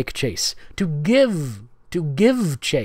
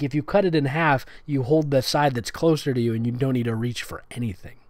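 normal talking voice

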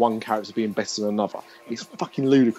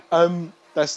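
ordinary talk, nothing marked

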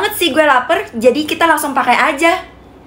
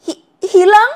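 frightened stammer; 5 semitones higher, 4 dB louder, slower than usual. Acted. Told colour makes you vanish.

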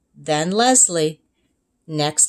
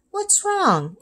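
higher mother's voice, concerned; caring mom; 13 semitones higher than usual. Acted. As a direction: ask gently as a worried mom. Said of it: "asked Mom".

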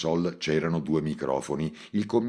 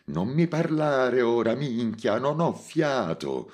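higher, breathless and slow; panting; 8 semitones higher, slower than usual. Acted. Montalbano, climbing the ladder, answers Mimì out of breath. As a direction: gasp it out while climbing. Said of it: "ansimò".

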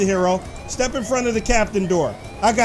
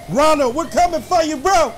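calling out; 5 semitones higher than usual. Pretend. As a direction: yell it as you storm the room. Charging in to confront Ronald.

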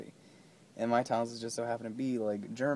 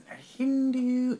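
silly high voice; 13 semitones higher, 7 dB louder, slower than usual. Pretend. Goofy high line.

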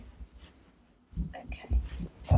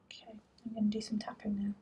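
whispering; 9 dB quieter than usual. Real, not acted. Quiet talk during exam.

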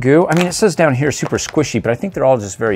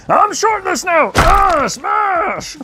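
much higher, hammed-up Hulk yell; much slower than usual. Vlogger voicing Hulk who lost his shorts, high mock yell. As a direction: scream it like a cartoon Hulk.

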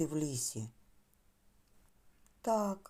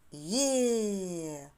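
long, falling cheer on «є»; joyful exclamation. Shown as a demonstration. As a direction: cheer «є» with the children, held long. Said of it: "ви будете радіти і вигукувати". (протяжний радісний вигук «є»)

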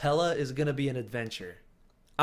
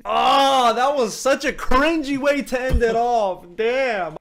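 cringing groan; 8 semitones higher, 7 dB louder, slower than usual. Real, not acted. Cringing reaction.

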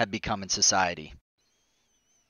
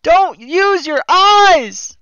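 mocking shriek; 11 dB louder, much slower than usual. Repeated, higher and louder.

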